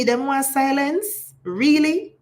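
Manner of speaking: incredulous "really?". Real, not acted. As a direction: scoff in disbelief at them wanting silence. Indignant rhetorical question to audience.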